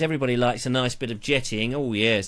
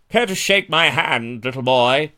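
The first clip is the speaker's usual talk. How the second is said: louder, hammed-up looming giant; creepy giant offer; 6 dB louder than usual. Stilt walker offering a child his giant hand, mock menacing.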